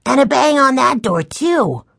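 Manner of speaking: put-on high, emphatic child's voice; indignant young boy. A narrator's reading. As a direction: add the damning detail like a little boy. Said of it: "Benny answered".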